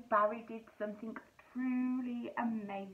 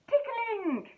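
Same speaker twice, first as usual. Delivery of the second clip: higher and louder burst; excited reveal; 11 semitones higher, 6 dB louder than usual. Acted. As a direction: pop the word like a surprise. Punchline reveal to children.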